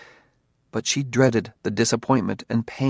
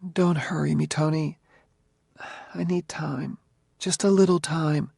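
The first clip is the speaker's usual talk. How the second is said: nervously; 6 semitones higher than usual. A narrator's reading.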